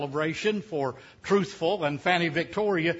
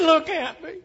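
put-on high falsetto old wife, pleading; Fanny Victoria's voice; 11 semitones higher, 5 dB louder than usual. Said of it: "She said".

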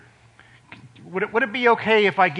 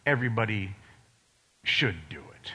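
quiet emphasis; 7 semitones lower, 8 dB quieter than usual. Acted. Preacher quietly tells the congregation everybody should read it. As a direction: hush it like a quiet charge.

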